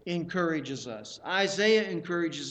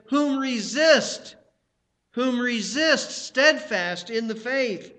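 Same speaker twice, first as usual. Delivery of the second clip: emphatic preaching; 6 semitones higher, 7 dB louder, slower than usual. Acted. Preacher quoting Peter with force.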